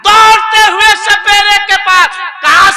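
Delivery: high, loud, breathless telling; frantic. Acted. Old woman rushing to the snake charmer in panic. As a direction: rush it out in panic.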